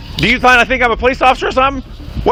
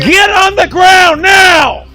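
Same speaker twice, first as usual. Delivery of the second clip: high, very drawn-out shout; shouted command; 5 semitones higher, much slower than usual. Real, not acted. Officer demanding the man get down now, shouted command. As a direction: stretch each word into a forceful police order.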